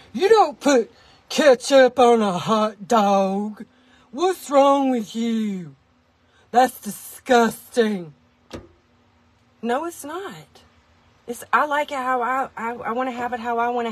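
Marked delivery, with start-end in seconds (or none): 0.1-8.1 slow, drawn-out mock outrage; outraged hate-mailer. Ketchup haters scolding the host, exaggerated slow outrage. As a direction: drag out every word like a scandalized critic. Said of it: "hate mail from people who are like".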